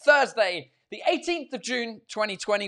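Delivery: loud, high announcer welcome; show announcer. Acted. Host welcomes viewers to his live show, announcer style. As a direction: boom the show open like a host.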